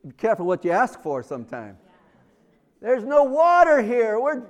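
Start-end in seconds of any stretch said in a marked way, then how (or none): 2.8-4.4 louder, higher, griping; loud complaint. Retold or imitated. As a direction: Israelites gripe about water, louder and higher. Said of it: "they're complaining".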